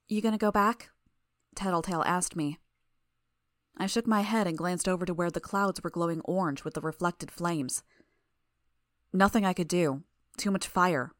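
usual speaking voice

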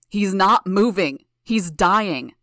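very loud, slow anguished roar; a father roaring over his dying son. A narrator's reading. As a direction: roar it with everything you have. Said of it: "The mayor's voice was a roar".

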